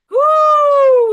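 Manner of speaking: very high, very loud whoop; excited whoop. Real, not acted. Streamer laughing at chat, letting out a wild whoop. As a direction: let out a wild woo.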